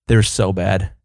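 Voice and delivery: ordinary talk, nothing marked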